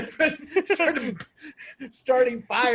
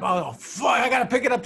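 higher-pitched, reluctant mock dread; mock panic; 6 semitones higher than usual. Son made to lift a spidery doghouse, mock panic. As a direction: imitate a kid dreading the job.

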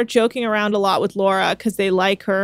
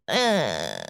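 low mock groan of disgust; 13 semitones lower, 5 dB quieter than usual. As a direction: imitate her revulsion, low and softer. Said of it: "she's so, like".

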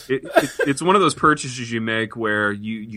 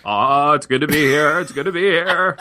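high-pitched folksy movie-star impression; higher-voiced character guest, climbing on the repeat; Jimmy Stewart; 5 semitones higher than usual. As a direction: put on an old film actor's voice, pitched up. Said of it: "Bill Clay sounded an awful lot like Jimmy Stewart".

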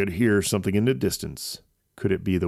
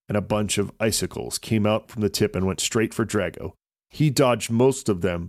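usual speaking voice

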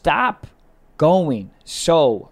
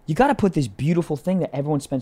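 fast quote; 2 semitones lower, faster than usual. Acted. Acted advice quote, fast.

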